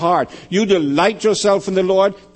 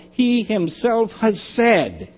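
"he himself" drawn out slowly and higher; stress on HE HIMSELF; 5 semitones higher, much slower than usual. Shown as a demonstration. Preacher pointing out who speaks in the verse, emphatic. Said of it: "The emphasis on who is making the statement".

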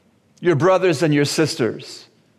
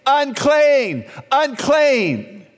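high-pitched cry, each call falling away; yelled warning; 9 semitones higher, much slower than usual. Acted. Leper warning healthy people off, yelling out.

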